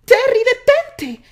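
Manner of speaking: high, loud call; shouting. A narrator's reading. Candy yells at Terry to stop his horse, high and loud. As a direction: yell for him to stop. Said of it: "le gritó".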